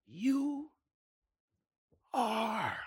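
soft, drawn-out "You ... are"; quiet, weighty "You are"; hushed divine pronouncement. Acted. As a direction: set "You" and "are" apart with a long pause, very softly. Quotes God's voice, hushed.

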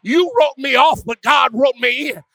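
ordinary delivery

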